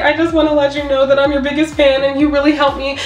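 high, rushed fan gush through tears; overwhelmed fan gushing to her idol; tearful gushing. Retold or imitated. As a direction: pour it all out fast while crying.

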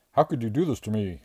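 jilted strongman crying out at his lover; wailed. A narrator's reading. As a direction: wail it, heartbroken and betrayed. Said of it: "wailed Bradley".

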